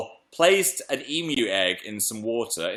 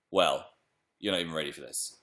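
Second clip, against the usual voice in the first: ordinary talk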